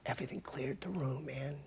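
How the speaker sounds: quick, whispered aftermath; breathy, awed whisper; hushed whisper. Real, not acted. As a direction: drop to a whisper after the big yell. Hushed climax after the yell.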